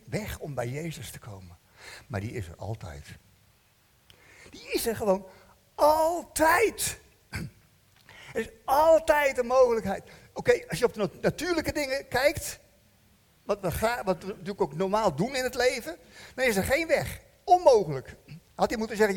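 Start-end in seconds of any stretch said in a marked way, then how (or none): none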